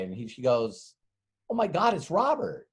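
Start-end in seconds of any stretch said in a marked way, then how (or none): 1.5-2.7 higher, delighted secretary; light up as you spot him; secretary thrilled to see Robert, pitched higher; excited recognition. Retold or imitated. Secretary greets returning visitor.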